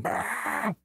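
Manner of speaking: high, wordless mock yell; drawn-out mock-yelled "bah"; imitated instructor yelling. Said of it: "they started".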